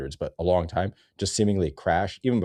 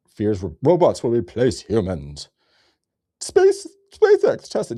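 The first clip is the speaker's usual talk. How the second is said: mocking read; 3 semitones higher, 7 dB louder, slower than usual. Mocking the robot article.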